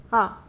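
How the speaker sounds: single short, theatrical "ha"; one burst of haughty amusement; scornful laugh. Acted. Vampire amused by visitor's request.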